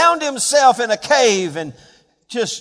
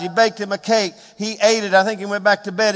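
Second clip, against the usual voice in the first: ordinary delivery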